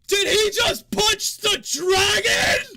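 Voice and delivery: high, booming shout, drawn out; announcer bellowing in disbelief; astonished arena announcer. Acted. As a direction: shout it incredulously to a whole arena. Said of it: "the announcer goes".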